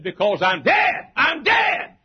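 emphatic pulpit cry rising to a high shout; fervent, escalating proclamation; preaching shout. Acted. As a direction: shout it out as Paul, slowing on the first phrase. Preacher shouts Paul's answer.

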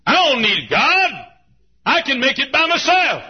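loud, high boastful voice; mock the proud man who won't pray; proud defiance. Proud man boasting he needs no God, loud and high.

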